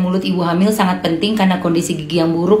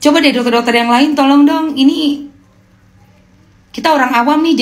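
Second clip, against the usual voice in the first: exasperated pleading; 7 semitones higher than usual. Real, not acted. Pleading with other doctors.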